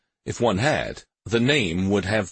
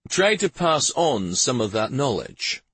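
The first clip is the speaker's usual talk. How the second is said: raised, higher-pitched officer's voice; commanding major; 6 semitones higher than usual. A narrator's reading. Major integrating new men into the watches, commanding. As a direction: address the troops as their major.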